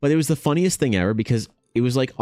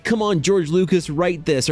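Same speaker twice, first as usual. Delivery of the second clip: imitated eager fan; 7 semitones higher than usual. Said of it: "they're just enthusiastic".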